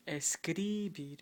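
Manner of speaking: "escribir" stressed before the last syllable, on CRI; wrong early stress. Shown as a demonstration. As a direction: slowly punch escribir as es-CRI-bir. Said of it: "It just automatically falls on the end".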